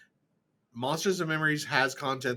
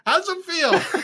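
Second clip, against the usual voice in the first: laughing; 5 dB louder, slower than usual. Real, not acted. Teasing after taking his pick.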